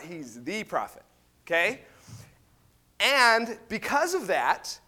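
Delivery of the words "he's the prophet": "the" singled out against "a"; stress on THE. Shown as a demonstration. Preacher correcting "a prophet", stress on "the". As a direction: lean on "the" as the one and only. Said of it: "He's not just a prophet".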